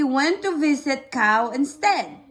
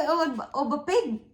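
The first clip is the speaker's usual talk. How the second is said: ordinary delivery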